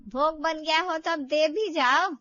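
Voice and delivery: loud impatient call. Acted. Mother-in-law calling daughter-in-law to bring the offering, loud call. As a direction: shout from the prayer room for the offering.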